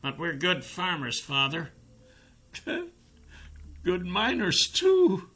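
high, soft tearful pleading; sobbing. A narrator's reading. Young captive swears innocence to his father, sobbing. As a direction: plead in a small crying voice. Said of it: "The young man sobbed".

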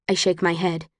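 usual speaking voice

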